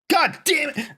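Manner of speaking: high-pitched burst of frustration; exasperated curse, pitched well up; exasperated outburst. Real, not acted. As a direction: let the pent-up rant burst out, pitch jumping. Outburst capping rant, apologizes after.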